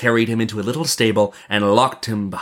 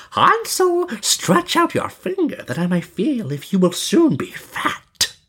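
very high, screechy witch call; 14 semitones higher, slower than usual. A narrator's reading. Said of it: "crept to the little stable and cried".

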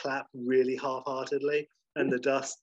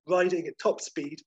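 usual speaking voice

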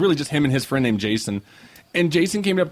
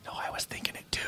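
imitated hushed reply, all breath; confiding whisper; 16 dB quieter than usual. Director admits the same thought to fan, whispering. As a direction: whisper back a shared secret.

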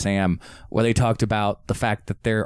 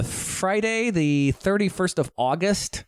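usual speaking voice

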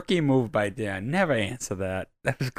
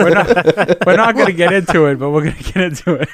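louder, higher, faux-serious; teasing mock protest; 5 semitones higher, 7 dB louder than usual. Cohost mocks the pledge to skip the story, louder and higher. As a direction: ham up the promise, then cave. Mocking the no-names promise.

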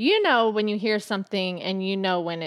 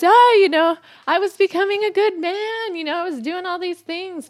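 high mocking voice of the husband, louder; mocking her husband; 10 semitones higher, 5 dB louder than usual. Wife mocking husband's boasting, high and hammed-up. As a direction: ham up the husband's self-praise, pitched high. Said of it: "Yeah, he says".

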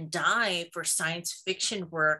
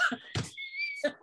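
high squealing laugh; 5 dB quieter than usual. Real, not acted. Laughing after imitating the reader.

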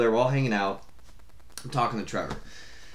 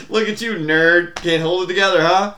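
louder, higher teasing jab, slowed at first; mock taunt; 5 semitones higher, 7 dB louder than usual. Teasing Trevor about being drunk, mock taunt. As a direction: rib a drunk friend who can't hold it.

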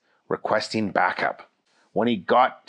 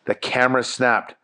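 ordinary delivery

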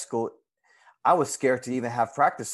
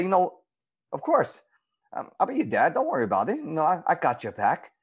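higher, retold reassurance to a girl; reassuring promise; 6 semitones higher than usual. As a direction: tell her you have her back, pitch raised. Promising to be her dad.

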